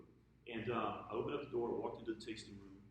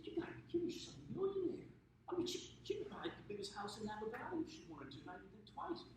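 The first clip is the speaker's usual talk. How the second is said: high-pitched, retold disbelief; incredulous; 12 semitones higher than usual. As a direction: act out astonished protest. Acts out his disbelief at staff.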